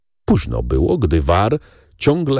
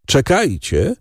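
mild, higher-pitched narrated shout; shouted interjection; 8 semitones higher, slower than usual. A narrator's reading. Baron stopping the company with a mild shout. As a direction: call out to halt them, at reading volume. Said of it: "krzyknął".

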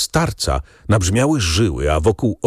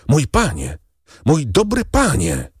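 pleading call, lifting higher on the repeat; wołanie; 5 semitones higher than usual. A narrator's reading. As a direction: call out to your master, imploring but not shouted. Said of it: "wołał". (błagalne wołanie wyższym głosem)

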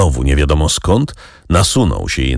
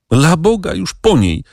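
put-on boy's cry; 12 semitones higher than usual. A narrator's reading. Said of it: "krzyknął chłopczyna".

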